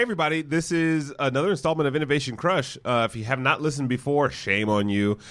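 ordinary talk, nothing marked

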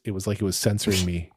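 usual speaking voice

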